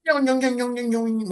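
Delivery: high ringing "yoing" wobble; imitated ringing. Drummer imitates the first cymbal drop, imitated ringing. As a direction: mimic a dropped cymbal ringing on and on. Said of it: "It was like".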